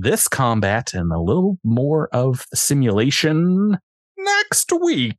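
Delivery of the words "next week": high, drawn-out trailer-voice tease; dramatic announcer. Acted.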